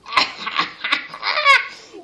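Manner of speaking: high, put-on witch's cackle. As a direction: cackle like the disguised queen. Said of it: "Even her voice was changed".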